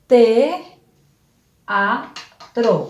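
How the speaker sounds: "te, a, tro" with a pause after each syllable; split into syllables. Shown as a demonstration. Teacher showing the class the hiatus, syllable by syllable. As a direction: say "te, a, tro" in three separate beats. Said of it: "Entonces tenemos".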